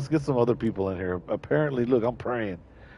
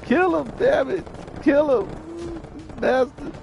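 high, slow dying-man voice; mock dying plea; 10 semitones higher, slower than usual. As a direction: beg for vengeance with mock last breaths. His character dies, begs for revenge.

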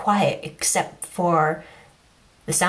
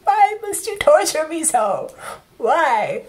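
mock anguish; 8 semitones higher, slower than usual. Overwhelmed fan, mock anguish.

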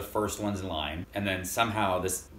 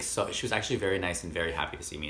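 normal talking voice

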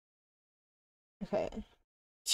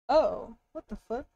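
ordinary delivery

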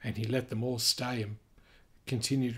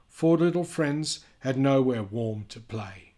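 lively storybook reading voice; sing-song read-aloud for kids; reading to children; 4 semitones higher, 8 dB louder than usual. A narrator's reading. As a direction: read it out like a picture book for little ones. Reading picture book aloud.

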